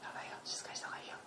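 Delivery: whispered, hurried warning; urgent hush in a whisper. Retold or imitated. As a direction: whisper it fast, as if keeping friends quiet.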